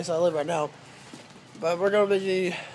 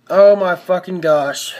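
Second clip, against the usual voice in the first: exasperated outburst; 10 dB louder, slower than usual. Real, not acted. Exasperated outburst at camera setup.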